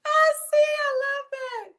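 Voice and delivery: high, loud squeal of delight. Real, not acted. Streamer reacting to a viewer, delighted squeal.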